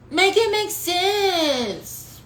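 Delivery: an incredulous slow high appeal; slow, high plea. Real, not acted. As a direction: stretch every word, pleading. Preacher pleading with congregation.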